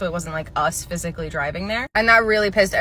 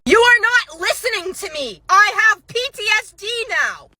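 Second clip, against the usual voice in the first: much higher, louder mock shriek; shrieking customer; 14 semitones higher, 6 dB louder than usual. Customer shrieking at the manager about her PTSD, mock meltdown. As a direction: screech it like a meltdown.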